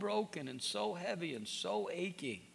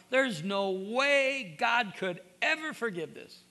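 higher, louder, slow pleading voice; mock despairing cry; 7 semitones higher, 7 dB louder, slower than usual. Preacher voicing a guilty listener, higher and louder, slow. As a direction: cry out as if beyond forgiveness.

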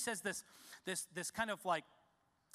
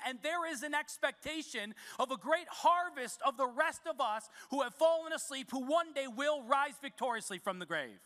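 higher, louder preaching build; rising preaching; 5 semitones higher, 3 dB louder than usual. Real, not acted. Pastor building to the climax for the congregation, fervent preaching. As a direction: lift the room toward the harvest.

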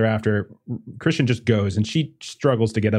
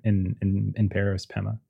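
normal talking voice

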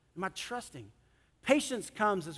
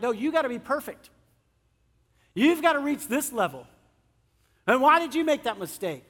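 higher, louder nagging demand; mock harsh judging; 5 semitones higher, 6 dB louder than usual. Preacher imitating us judging others, loud and demanding. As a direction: scold like a fussy perfectionist.

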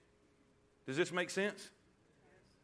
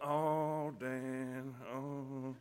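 low, soft mock flattery trailing away; butter them up, sinking softer on each bit; 5 semitones lower, 6 dB quieter, much slower than usual. Spouse or kids buttering someone up before a request, mock flattering.

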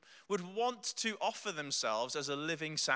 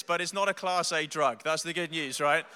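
ordinary talk

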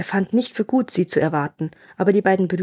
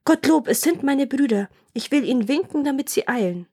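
higher voice, mildly excited cry; lightly relieved sister, raised pitch; relieved call; 6 semitones higher than usual. A narrator's reading. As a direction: call out the good news in a high voice. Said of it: "rief sie".

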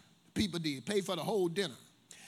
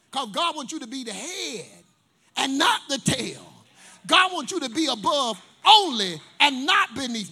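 loud rising preacher's cry; preaching at full force; 9 dB louder than usual. Acted. Preaching climax.

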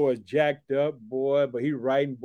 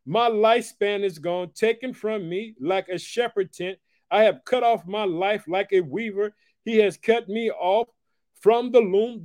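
raised, preached scripture reading; 8 semitones higher than usual. Acted. Preacher reads Hezekiah's lament to the congregation, raised and preached. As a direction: read it out like a lament from the pulpit.

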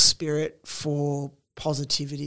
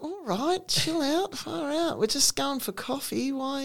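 lightly voiced, higher, softer put-down; mock the laid-back locals telling her to calm down; unimpressed Australians; 10 semitones higher, 4 dB quieter than usual. Australians telling her to chill, mild mock drawl. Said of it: "and everyone's like".

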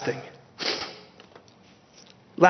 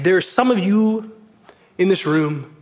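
usual speaking voice

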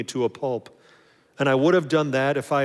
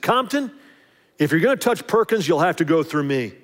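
louder, higher warning to the bully; tough threat; 3 semitones higher, 5 dB louder than usual. Retold or imitated. Big friend threatening the bully, loud tough warning. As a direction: stand over him and warn him.

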